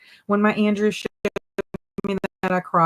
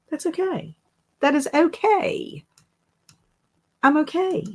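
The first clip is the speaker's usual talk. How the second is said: mock self-reassurance; 10 semitones higher than usual. A host reassuring herself after admitting a mix-up, high and sing-song.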